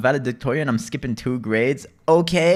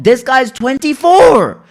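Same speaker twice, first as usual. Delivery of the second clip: loud, high, drawn-out protest; exasperated yell; 11 semitones higher, 10 dB louder, slower than usual. Retold or imitated. Son protesting to mom about grown brother, exasperated yell. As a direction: stretch it into an indignant yell.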